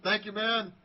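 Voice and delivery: high and loud, calling back; called-out thanks. Retold or imitated. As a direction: call it back from the doorway. Stranger calls thanks from the door.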